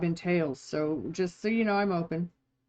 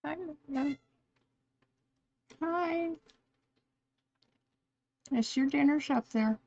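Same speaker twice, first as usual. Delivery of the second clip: high sing-song pet voice; talking to a pet; 9 semitones higher than usual. Real, not acted. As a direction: coo like greeting a pet.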